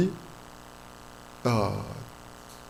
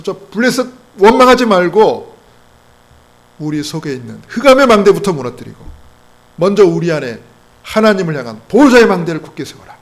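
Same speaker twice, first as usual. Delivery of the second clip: very loud, punched exhortation; forceful preaching; 4 semitones higher, 10 dB louder than usual. Real, not acted. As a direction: drive each phrase home at full voice.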